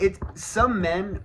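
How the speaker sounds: usual speaking voice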